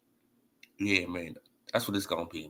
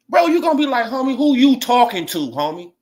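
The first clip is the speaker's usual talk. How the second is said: high, loud "homie, who you talking to"; mock confrontation; 7 semitones higher, 8 dB louder than usual. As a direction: bark the challenge like you mean it. Voicing the proper reaction.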